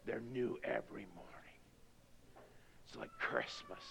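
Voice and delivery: awed whisper; hushed wonder. Real, not acted. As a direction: whisper it like a Christmas secret. Hushed wonder at new mornings.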